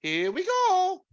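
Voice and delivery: high, slow Italian Mario. Retold or imitated. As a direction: stretch it out like Mario. Doing Mario catchphrase.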